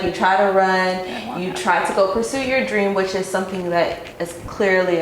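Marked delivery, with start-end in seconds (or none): none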